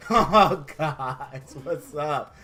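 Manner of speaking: mock-stoned mellow hello; stoned George Lucas. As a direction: say it hazily, trailing down low. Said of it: "His ass is high as F".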